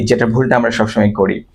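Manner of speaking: ordinary delivery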